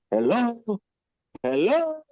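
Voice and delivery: imitated high-pitched hello, rising at the end; calling out. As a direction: call for someone who is not answering. Owner calling out to customer outside.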